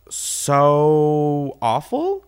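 drawn-out, exasperated complaint. Real, not acted. Ranting on air about dating, exasperated. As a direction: stretch it out in sheer exasperation.

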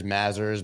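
"Mazars" said as MAZ-ers; first syllable of "Mazars" as in jazz; alternative pronunciation. Shown as a demonstration. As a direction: say "Mazars" with a short A, stressed first. Tries three pronunciations of the name.

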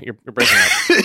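laughing fake bad connection. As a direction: squeal it through a laugh like the line is dropping. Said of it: "He should, said".

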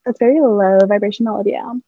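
mock a spiritually superior scolder, lifted pitch; changed, put-on voice. Mimicking people who swapped "sin" for spiritual jargon, mock reproach. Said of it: "you changed your voice".

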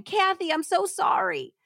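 high-pitched tearful Southern apology, lightly acted; hammed-up weepy apology; mock crying. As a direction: plead as if crying. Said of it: "Susan grabbed the phone crying".

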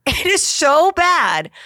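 high, slow, drawn-out disgust; appalled. Real, not acted. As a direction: stretch it out, appalled. Appalled emphasis.

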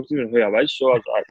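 ordinary talk

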